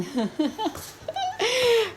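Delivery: high-pitched burst of laughter; giggling. Real, not acted. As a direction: laugh at the silly video. Laughing at the video.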